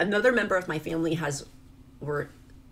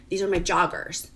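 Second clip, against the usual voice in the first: ordinary delivery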